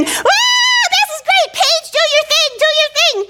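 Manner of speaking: very high, thin mock scream; acted playground shrieking; screaming with glee. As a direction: squeal like a kid on a spinning ride. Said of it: "we start screaming".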